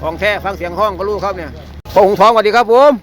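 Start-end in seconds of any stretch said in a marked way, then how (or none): 1.9-2.9 loud, high greeting to someone hard of hearing; speaking up loudly. Real, not acted. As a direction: project it so he can hear. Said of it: "พูดดังๆเนาะ เสียงหูไม่ค่อยดี". (พูดเสียงดังให้ได้ยินชัด)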